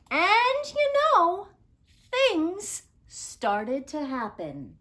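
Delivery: lively read-aloud storyteller voice; story narration. A narrator's reading. Teacher reads the story aloud to her class, lively storytelling. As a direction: tell it like a picture book to kids.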